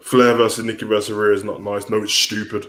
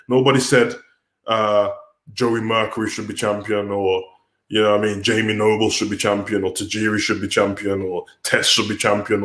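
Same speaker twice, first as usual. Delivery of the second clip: ordinary talk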